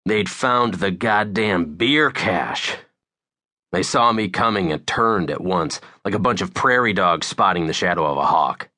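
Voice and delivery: ordinary delivery, nothing marked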